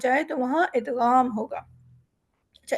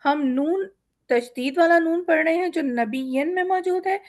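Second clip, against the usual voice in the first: usual speaking voice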